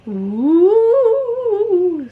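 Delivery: high, loud ghostly ooh; ghost moan. Acted. The ghost greeting the hosts with a high ghostly moan. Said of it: "said the ghost".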